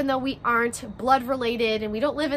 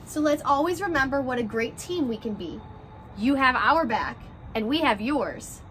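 gentle picture-book read-aloud, rhymes bouncing along; reading to children; 3 semitones higher than usual. A narrator's reading. Teacher reading a class picture book to her first graders, story-time voice.

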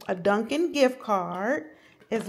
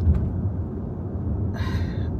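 weary sigh. Real, not acted. Fed up with the cold.

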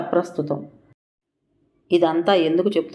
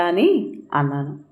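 plain reading voice

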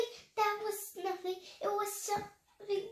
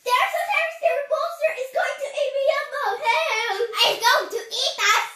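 high, loud, rapid alarm; yelling; 8 semitones higher, 8 dB louder, much faster than usual. Acted. Keeper tricking Mom about a monster, alarmed yelling. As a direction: shout a breathless-fast false alarm. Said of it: "Yelled Keeper".

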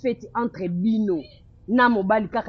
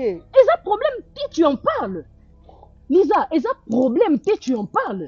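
incredulous protest; 4 semitones higher than usual. Acted. Friend rejects Lisa's no-problem claim.

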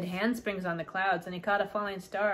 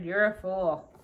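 ordinary delivery

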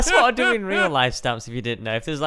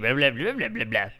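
gibberish babble; 10 semitones lower, 3 dB quieter than usual. Pretend. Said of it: "You're speaking in gibberish".

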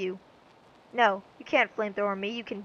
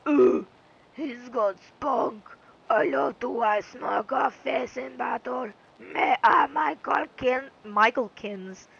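slow villain voice; slower than usual. Acted. Voicing game villain.

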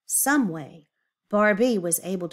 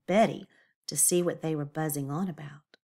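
ordinary delivery

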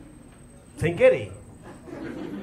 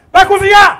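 loud, higher mock sergeant command; barked order; 6 semitones higher, 9 dB louder than usual. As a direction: bark the order at the recruit.

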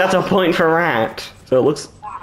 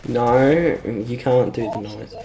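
ordinary talk

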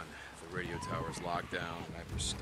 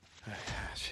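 tired sigh; 3 semitones higher than usual. Acted. Alone in bunker.

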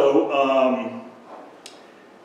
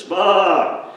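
loud, higher lamb's cry; distant lamb bleat; 7 semitones higher, 6 dB louder than usual. Acted. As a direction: call out like a lost lamb. Lamb calling.

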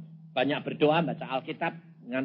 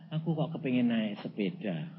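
soft, low, timid wish; hesitant wife; 5 semitones lower, 6 dB quieter than usual. Retold or imitated. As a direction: voice a shy request as the wife. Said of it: "Dia takut ragu-ragu".

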